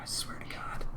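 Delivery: breathless whisper of exasperation; whispered oath. Real, not acted. Streamer giving up on the plugin, whispering. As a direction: whisper it like you've given up. Exasperated aside after laughing.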